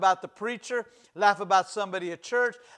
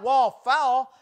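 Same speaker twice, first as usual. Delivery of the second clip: louder, commanding cry of wall fall; mock wall command; 3 semitones higher, 6 dB louder than usual. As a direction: boom it like an order to the wall. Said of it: "And they didn't shout".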